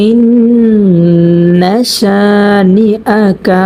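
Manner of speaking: slow, drawn-out tajweed chant; Quran recitation. Shown as a demonstration. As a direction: recite it slowly, stretching the vowels. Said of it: "যেমন".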